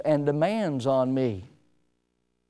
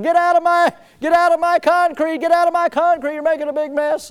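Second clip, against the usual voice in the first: high, loud angry yelling; retold yell; 10 semitones higher, 5 dB louder than usual. Man yelling at the boy in his wet concrete, loud and high. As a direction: yell at the kid to get off. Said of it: "and he was yelling".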